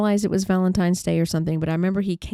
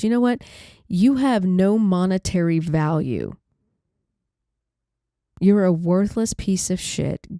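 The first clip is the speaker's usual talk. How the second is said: retold angry yelling; 3 dB louder than usual. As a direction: repeat his cruel words with some of the force. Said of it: "he's yelling at me".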